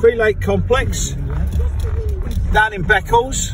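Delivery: talking while bouncing along; on a bumpy road. Real, not acted. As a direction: voice jolted by bumps. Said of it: "Excuse me for the bouncing about".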